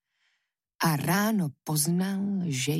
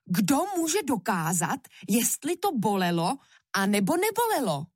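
high-pitched indignant devil; heated, incredulous objection; outraged exclamation; 6 semitones higher, faster than usual. A narrator's reading. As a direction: argue back, pitch raised in protest. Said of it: "vykřikl".

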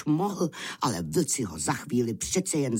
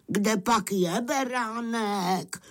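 high, slow, drawn-out calling; seeking wolves; 11 semitones higher, slower than usual. Acted. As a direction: call out searchingly, stretching the words. Blindfolded wolves call for the lamb. (vlci protáhle volají vysokým hlasem)

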